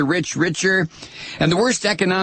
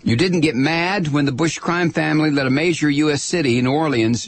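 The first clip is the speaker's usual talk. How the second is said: ordinary delivery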